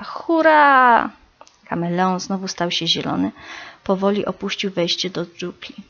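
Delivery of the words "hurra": high, loud cheer; joyful squeal. A narrator's reading. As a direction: burst out cheering together. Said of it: "jednogłośnie zapiszczała".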